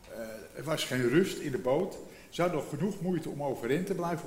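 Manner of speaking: ordinary talk, nothing marked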